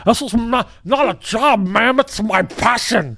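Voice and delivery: high-pitched, indignant put-on voice; [name]. Business owner defends his passion to ma'am, high-pitched indignation. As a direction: plead your passion in a high, hammed-up voice. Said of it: "High-pitched [name]".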